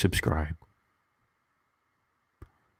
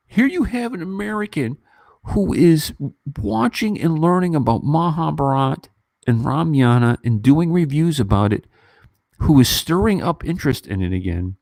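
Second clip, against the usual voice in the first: impassioned plea; 8 semitones higher than usual. Retold or imitated. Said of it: "to write an email to Penn Studios to say".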